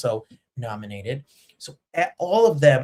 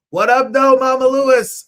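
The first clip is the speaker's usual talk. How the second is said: higher, louder "What up, though, Mama Lewis?"; loud calling greeting; 11 semitones higher, 11 dB louder than usual. Shown as a demonstration. Host calls out a big louder greeting to his mother.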